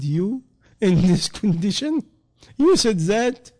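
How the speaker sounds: high, incredulous question with a small laugh; can't believe what he just heard; amazed disbelief, rising loud; surprised. Retold or imitated. Astonished reply to drunk man.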